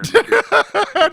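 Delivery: high loud burst of laughter. Real, not acted. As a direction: crack up loudly. Friend laughs at phone mixup.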